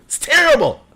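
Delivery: high-pitched exaggerated exclamation; mock outraged cry. Real, not acted. Streamer judging his own impression, high mock outrage. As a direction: blurt it high in comic dismay.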